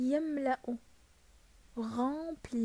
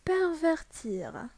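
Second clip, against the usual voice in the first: slow model word; 5 semitones higher, much slower than usual. Shown as a demonstration. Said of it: "عاودها, s'il vous plaît".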